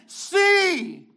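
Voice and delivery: a loud, high, ringing shout; with exclamation marks. Shown as a demonstration. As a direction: call it out like a command to look. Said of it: "This needs to have a bang symbol".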